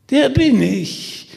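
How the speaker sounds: high, slow, eerie shriek. Acted. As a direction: shriek it with a grin. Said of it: "kreischte jener". (gruselig gekreischt)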